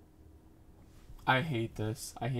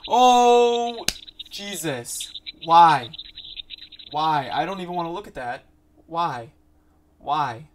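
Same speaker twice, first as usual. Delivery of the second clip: loud high cry, then higher-pitched protests; horrified outburst; 5 semitones higher, 11 dB louder, slower than usual. Real, not acted. Viewer who won't look at the screen, horrified loud protest. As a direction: burst out, then keep protesting in real distress.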